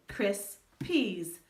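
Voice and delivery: cris, peas said slowly as two separate beats; slow, separated cris and peas; syllable pair. Shown as a demonstration. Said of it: "just say that part".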